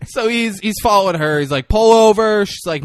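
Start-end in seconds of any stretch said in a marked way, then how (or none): 1.7-2.5 loud shouted command; yelling. Retold or imitated. Cop yelling at fleeing driver to pull over. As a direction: bark it after the car. Cop chasing her car.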